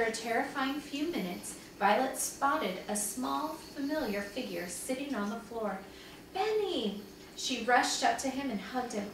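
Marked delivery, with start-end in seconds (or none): 6.3-7.0 high storybook call of a name; mildly excited cry; relieved call. A narrator's reading. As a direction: call out to the found child, pitched up. Sister spots her lost brother.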